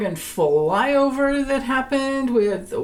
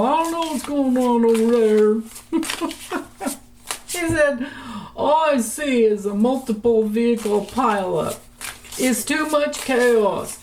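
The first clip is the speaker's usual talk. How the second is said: imitating John Kennedy; 2 semitones higher than usual. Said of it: "I can't do his accent".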